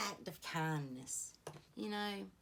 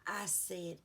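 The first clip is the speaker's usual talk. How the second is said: normal talking voice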